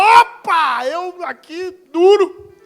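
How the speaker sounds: high, loud, stretched-out excitement; acted eager David. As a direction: play a broke young man jumping at the offer. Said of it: "Ele falou".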